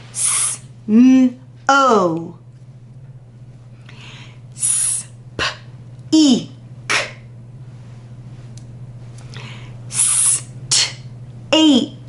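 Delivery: sounds said apart, partly whispered; teacher sounding out words; segmented sounds. Shown as a demonstration. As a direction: breathe out each sound separately. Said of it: "Your turn".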